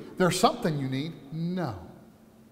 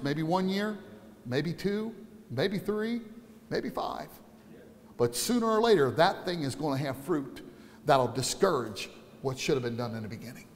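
dropping to quiet; 6 semitones lower, 11 dB quieter than usual. Acted. Preacher drops voice.

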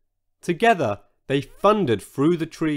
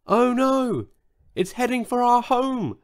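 high, breathless alarm; a gasp of panic, voice raised high; alarmed gasp, pitched up; 10 semitones higher than usual. A narrator's reading. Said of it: "gasped Saizo".

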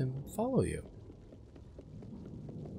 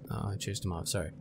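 ordinary delivery

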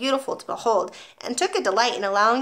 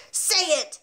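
higher, forceful bellow; 8 semitones higher than usual. A narrator's reading. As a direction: command him. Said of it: "bellowed the Trunchbull".

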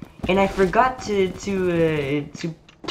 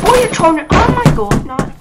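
high squeaky voice, loud and cocky; squeaky taunting gamer; 8 dB louder than usual. Acted. Gamer taunting an opponent in a squeaky cartoon voice. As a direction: taunt the enemy in a silly high voice.

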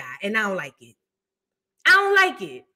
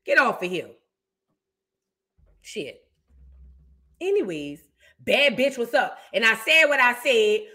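usual speaking voice

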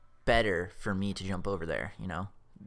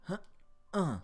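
ordinary talk, nothing marked

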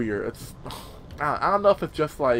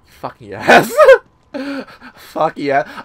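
loud triumphant yes; a sudden loud burst of joy; excited; 7 semitones higher, 11 dB louder, much slower than usual. Real, not acted. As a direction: cheer at the prize. Gets the Magnum upgrade.

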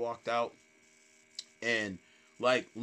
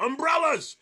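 put-on drunk heckler; 13 semitones higher, 6 dB louder than usual. Imitating drunk heckler's random yell.

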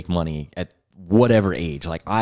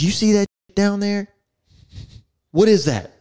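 higher, pointed demand; a boss catching a sloppy job; mock stern father; 13 semitones higher than usual. Father quizzing his son about trash, sternly.

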